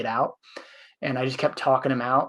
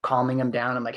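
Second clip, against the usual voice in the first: ordinary talk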